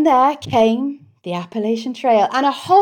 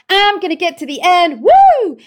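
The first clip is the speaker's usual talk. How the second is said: upbeat quote ending in a higher, louder whoop; excited cheer; 6 semitones higher, 4 dB louder than usual. Retold or imitated. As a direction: cheer at the start of the hike. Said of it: "it was all about".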